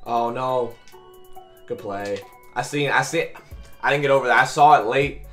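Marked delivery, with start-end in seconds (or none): none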